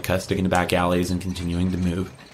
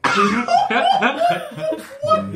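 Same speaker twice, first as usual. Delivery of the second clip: shrieking high laugh; high burst of laughter; 4 dB louder than usual. Real, not acted. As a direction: crack up, squealing. GM cracks up at joke.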